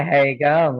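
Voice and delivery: imitated broad Aussie greeting; Australian accent. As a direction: put on an Aussie mate's accent. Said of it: "he liked the Australian accent".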